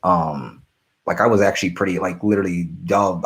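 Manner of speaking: ordinary delivery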